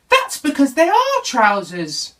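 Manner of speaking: small bear setting him straight; Little Bear, a bit exasperated. Acted. Little Bear tells Sailor his sails are trousers, mildly exasperated.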